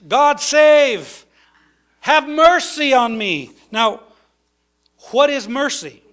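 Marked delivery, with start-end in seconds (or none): 0.1-3.5 loud, high beggar's plea; drawn-out raised cry for help; calling out. Acted. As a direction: cry out for mercy over the crowd. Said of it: "calling out".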